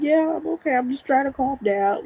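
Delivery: high-pitched brave reassurance; reenacted strained "I'm fine" to mom; holding back tears. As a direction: act out keeping yourself from crying. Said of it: "I'm trying not to cry".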